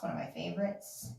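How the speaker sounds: normal talking voice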